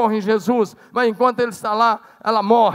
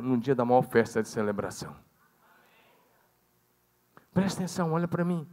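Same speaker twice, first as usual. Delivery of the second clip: softer, lower intimate sermon voice; quiet preaching; 6 semitones lower, 6 dB quieter than usual. Acted. Preacher lowers voice to draw congregation close, softer. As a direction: draw them in with a hushed tone.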